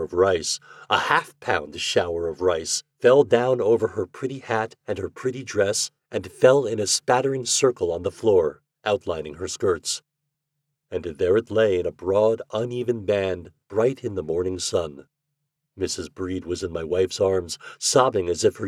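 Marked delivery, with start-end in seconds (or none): none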